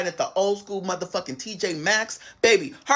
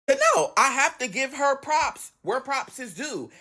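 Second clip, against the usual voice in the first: ordinary talk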